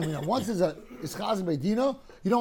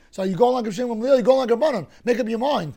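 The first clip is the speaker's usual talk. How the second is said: exasperated challenge; 4 semitones higher, 6 dB louder than usual. Acted. Challenging the Gemara's contradiction.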